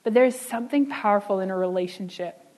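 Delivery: normal talking voice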